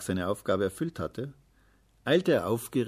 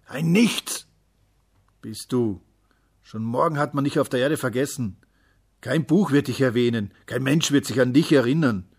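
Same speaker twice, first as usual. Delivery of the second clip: loud, raised, hammering tirade; contemptuous uncle shouting someone down; shouted scorn; 4 semitones higher, 9 dB louder than usual. A narrator's reading. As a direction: pound out every insult at full voice. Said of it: "schrie der Onkel".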